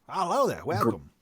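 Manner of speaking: hammed-up female dwarf greeting. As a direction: greet them as the stuck-on dwarf. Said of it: "I'm really stuck in this voice".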